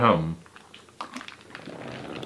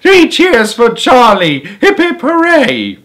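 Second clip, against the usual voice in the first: cheering; 12 semitones higher, 7 dB louder, slower than usual. A narrator's reading. Grandpa cheering.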